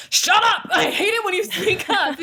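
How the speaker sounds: hammed-up shout with a laugh; mock angry. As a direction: scold a younger self, playfully. Scolding past self, acted.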